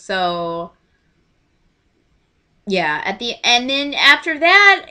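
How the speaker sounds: ordinary talk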